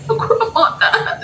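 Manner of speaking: high, slow wail dissolving into squeals; crying. Retold or imitated. Retelling the bad news at the counter, high mock crying. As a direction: imitate your own sobbing, drawn out and very high. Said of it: "And I'm crying".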